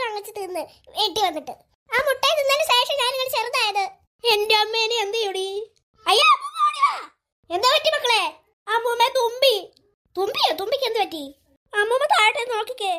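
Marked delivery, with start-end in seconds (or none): 6.0-6.9 high, loud panicked cry for grandma; shrill alarmed shout; frantic call for help. Acted. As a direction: yell across the house for help. Child shrunk, calls grandma for help.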